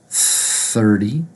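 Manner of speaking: "thirty" as "thirdy" after a long TH. Shown as a demonstration. Teacher modelling thirty, D sound. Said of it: "actually gonna be pronounced with a D sound".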